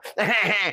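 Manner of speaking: put-on a boy's cheeky giggle; naughty little laugh; mischievous giggle. A narrator's reading. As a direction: giggle at the prank. Oliver laughing at pushed sheep.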